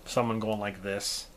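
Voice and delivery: ordinary talk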